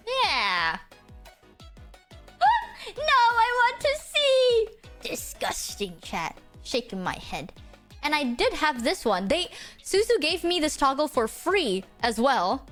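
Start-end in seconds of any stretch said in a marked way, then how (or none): 5.0-7.5 soft breathy mock disgust; whispered disgust. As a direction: whisper it, lower and disapproving.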